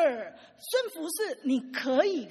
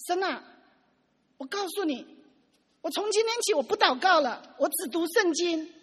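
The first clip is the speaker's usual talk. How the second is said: higher and louder, a fed-up complaint thrown at God; 生气地向神抱怨; 5 semitones higher, 6 dB louder than usual. Retold or imitated. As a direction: slap the Bible down and declare it, exasperated. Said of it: "他就这样很生气的这样". (气冲冲地、提高嗓门对神抱怨)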